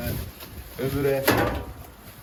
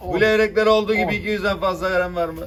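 auctioneer; 6 semitones higher, 7 dB louder than usual. Acted. Fish auction calling prices.

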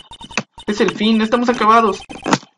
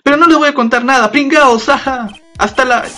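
put-on bratty kid voice; 7 semitones higher than usual. Voicing a cheeky boy.

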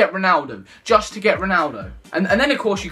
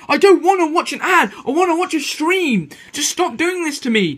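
real annoyance, louder and higher, yelling at the screen; frustrated outburst; 6 semitones higher, 4 dB louder than usual. Real, not acted. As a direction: blow up at the ad that won't let him watch. Ad interrupts the stream he wants.

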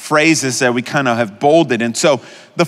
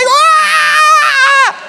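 high loud scream of joy; mock joyful scream; 6 dB louder than usual. Pastor imitating a jackpot winner, joyful scream. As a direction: scream like you just won money. Winning a lot of money.

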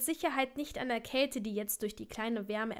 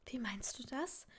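soft, breath-led uncertain question; quiet and tentative; unsure; 9 dB quieter than usual. A narrator's reading. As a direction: ask it hesitantly, almost whispering. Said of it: "fragte sie ihn unsicher".

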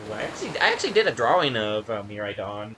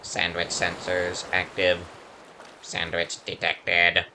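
low, slow robotic monotone; 9 semitones lower, 3 dB quieter, slower than usual. Acted. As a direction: speak like a deadpan machine. Voices the robot lizard.

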